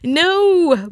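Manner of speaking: high, pleading no, no; high protesting no. Retold or imitated. To the teasers, a high dismayed protest. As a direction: squeal a dismayed no. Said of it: "And I'm like".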